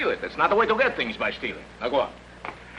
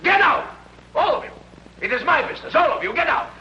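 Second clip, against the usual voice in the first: much higher, much louder angry yell; furious shout; 4 dB louder, faster than usual. Acted. Shoemaker ordering his neighbours out, shouting furiously. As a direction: drive them all out with rage.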